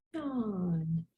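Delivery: soft, drawn-out sleepy yawn. Acted. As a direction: let a big sleepy yawn out. Narrator acts out the yawn.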